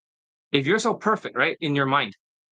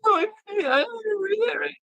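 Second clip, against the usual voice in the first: much higher falsetto with fake sobbing; mock crying whine. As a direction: ham up a crybaby's excuse. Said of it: "That's how they sound like".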